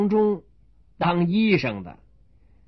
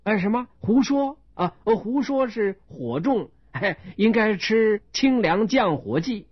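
higher, earnest surprise then advice; much higher innocent doctor; puzzled doctor; 7 semitones higher than usual. Acted. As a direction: take the insult as a symptom. Doctor misreads insult as symptom.

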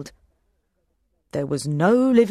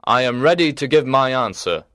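knight voice; 6 semitones lower than usual. Acted. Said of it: "the knight said".

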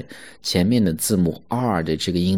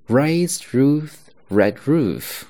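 slow reading; 7 semitones higher, much slower than usual. Shown as a demonstration. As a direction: raise Ruth red roof, deliberate. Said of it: "我再重新慢速的去读一下啊". (慢速朗读)

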